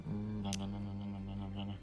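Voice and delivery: usual speaking voice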